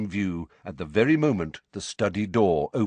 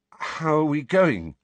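shaken; 8 semitones higher, 4 dB louder, slower than usual. Acted. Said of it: "looking shaken".

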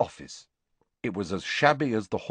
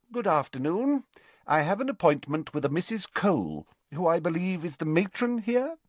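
higher, politely formal visitor; courteous younger headmaster; 9 semitones higher than usual. Acted. Visitor asking a girl for the matron, courteously. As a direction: lilt upward with formal courtesy.

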